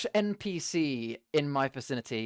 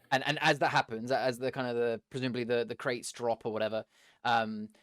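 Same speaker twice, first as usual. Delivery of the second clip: ordinary delivery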